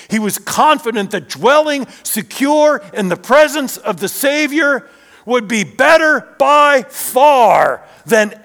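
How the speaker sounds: loud, high, ringing sermon delivery; full-force preaching. Real, not acted. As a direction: preach it out with swelling conviction.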